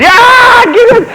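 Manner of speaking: very high mock scream of fleeing men; hammed-up high-pitched panic cry; panicked yell. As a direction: shriek like soldiers breaking and running. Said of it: "they're panicking".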